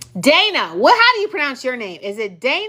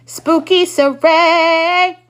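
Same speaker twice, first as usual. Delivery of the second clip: slow, louder, spooky drawl; playful spooky; 5 semitones higher, 5 dB louder, much slower than usual. Real, not acted. Teasing Saray with a spooky nickname, slow drawn-out. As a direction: stretch it out like a ghost story.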